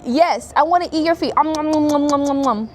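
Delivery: put-on loud, high baby talk into munching noises; mock-gobble a little nephew's feet; playful teasing. Teases nephew about eating feet.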